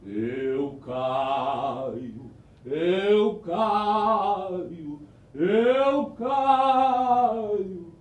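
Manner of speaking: a ghost moaning from the ceiling; strange ghostly voice. Acted. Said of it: "aquela voz voltou a dizer". (voz estranha de assombração)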